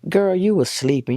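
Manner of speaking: dismissive mother. Retold or imitated. Mom denies, dismissing daughter.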